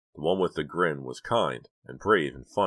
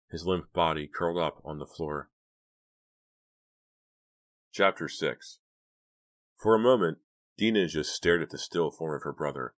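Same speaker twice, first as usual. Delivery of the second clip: ordinary delivery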